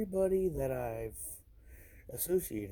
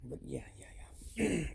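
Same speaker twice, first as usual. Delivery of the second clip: whispering; 6 semitones higher than usual. Real, not acted. Trailing off into a whisper.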